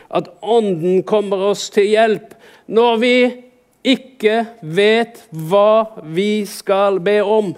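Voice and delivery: slow, deliberate recitation of a verse; emphatic scripture reading. Real, not acted. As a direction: spell it out slowly, word by word. Reads out a Bible verse.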